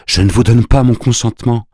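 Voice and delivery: emphatic refusal, trying to hold firm; firm but tearful. A narrator's reading. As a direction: struggle to sound firm while close to tears. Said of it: "parler d'un ton ferme, mais déjà elle pleurait".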